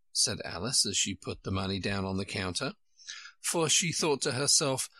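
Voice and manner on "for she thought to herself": plain reading voice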